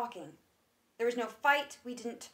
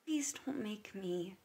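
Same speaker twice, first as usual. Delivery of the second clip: softer, unvoiced plea; pleading whisper; 4 semitones lower, 9 dB quieter than usual. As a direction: beg quietly, almost breaking. Begging not to tell.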